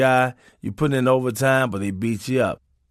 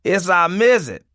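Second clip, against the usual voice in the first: mock angry scolding; 6 semitones higher, 4 dB louder than usual. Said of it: "The woman got mad and said".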